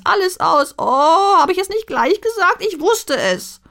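high, loud, whiny dwarf voice lamenting; a gloomy dwarf lamenting that all is lost; shrill-pitched loud moaning complaint; wailing dwarf. A narrator's reading. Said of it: "jammerte der Zwerg".

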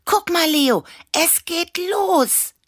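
high-pitched, loud squeal of excitement; excited call. A narrator's reading. Annabella calls Leo to the fireworks, excited shout. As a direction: call your friend to look, very high. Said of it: "rief Annabella".

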